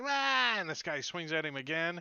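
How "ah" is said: loud, high acted yelp; startled yell. Game master voices Pinch dodging a sword, startled yell. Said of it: "He's like".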